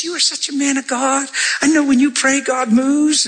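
high, gushing flattery; buttering him up. Retold or imitated. Church lady flattering the pastor: high gushing voice. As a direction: lay the praise on thick, pitched high. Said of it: "she's buttering him up".

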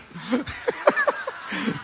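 high, soft laugh. Real, not acted. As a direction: laugh at your own joke. Laughs at his own joke.

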